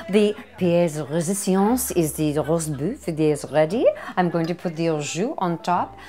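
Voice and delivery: playful put-on French accent. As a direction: present the dish like a mock French host. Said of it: "I don't know why I'm talking French".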